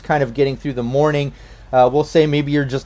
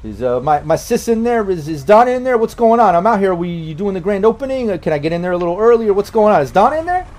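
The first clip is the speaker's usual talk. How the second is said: higher-pitched Bronx guy on the phone; pushy Bronx brother, pitched up; Bobby Bourbon, Bronx brother; 6 semitones higher than usual. Acted. Brother phones the cafe asking for his sister, higher Bronx voice. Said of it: "from the Bronx".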